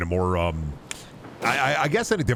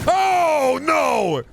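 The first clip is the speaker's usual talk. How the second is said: startled cry; 5 dB louder than usual. Real, not acted.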